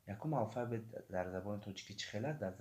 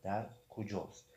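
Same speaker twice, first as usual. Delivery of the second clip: ordinary talk, nothing marked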